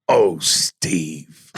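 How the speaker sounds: low, drawn-out mock plea to a knocked-out friend; mock tender plea. Leaning over a friend he slapped, mock plea.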